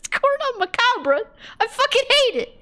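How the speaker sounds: high-pitched comic rant; exasperated outburst. Real, not acted. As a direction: let the annoyance shoot way up high. Ranting about a hated pronunciation.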